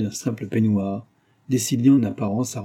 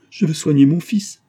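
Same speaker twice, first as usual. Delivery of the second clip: loud, high protest; crying out; 6 semitones higher, 6 dB louder than usual. A narrator's reading. Wife clinging to the cradle, crying out. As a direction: exclaim it insistently, raised and forceful. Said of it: "s'écria Marianne".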